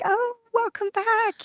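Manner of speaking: very high, drawn-out welcome; retold sing-song greeting. Greeting coworker back from maternity leave, retold high and drawn-out. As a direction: imitate a slow, high-pitched hello.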